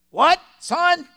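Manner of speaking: very loud, high shout; hard-of-hearing old man. Acted. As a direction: bellow like a deaf old man.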